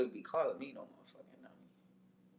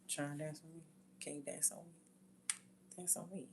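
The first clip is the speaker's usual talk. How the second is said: soft, understated mock pick-up line; 12 dB quieter than usual. Man asking a woman to dance, imitated softly. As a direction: drop the volume, casually asking for a dance.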